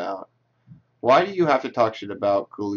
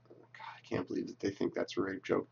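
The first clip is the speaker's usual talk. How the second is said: a real hushed whisper, much softer; whispered aside; 3 semitones lower, 14 dB quieter than usual. Real, not acted. Reacting to a tweet, aside to himself, whispered. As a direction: mutter it under your breath, disbelieving.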